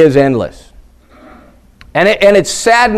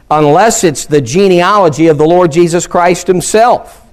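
usual speaking voice

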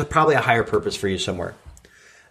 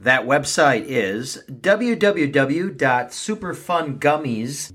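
sponsor announcer read; 5 semitones higher than usual. Acted. Host interrupting his own podcast for a sponsor, announcer voice. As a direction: hype it like a late-night infomercial.

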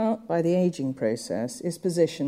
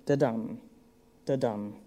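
da-dum with the dum stressed, sinking softer; iambic rhythm; 4 semitones lower, 4 dB quieter than usual. Shown as a demonstration. Lecturer demonstrating iambs to students, rhythmic da-DUM. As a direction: tap out the beat: da-DUM, da-DUM. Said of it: "giving the rhythm".

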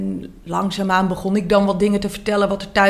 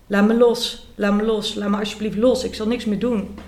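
ordinary delivery

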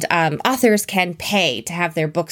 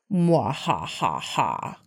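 mad scientist; 7 semitones lower, 6 dB quieter, much slower than usual. Pretend. Gloating evil laugh after scheme.

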